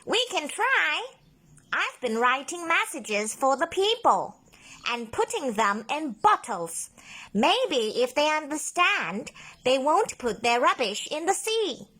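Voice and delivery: high, hopeful octopus explaining. A narrator's reading. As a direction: share the plan eagerly. Ollie explains his plan.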